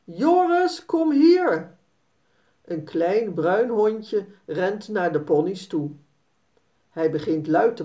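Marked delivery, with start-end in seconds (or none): 0.0-1.8 high call across the field to his dog; farmer calling loudly. Acted. Farmer calls his dog across the field, high and loud. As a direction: call the runaway dog back, sharp and high. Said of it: "horen ze de boer hard roepen".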